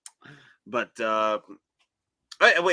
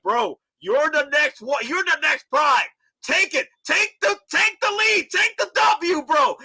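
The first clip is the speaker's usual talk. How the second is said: much higher, hyped encouraging voice; Optimus quote; 8 semitones higher than usual. Retold or imitated. Optimus urging Rodimus to take the lead, hyped. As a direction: cheer him on as a proud mentor. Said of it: "The Optimus Prime was like".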